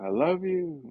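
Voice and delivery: hollered up into the high register; retold scream. To the mirror at night, a high mock scream. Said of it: "Just screaming it".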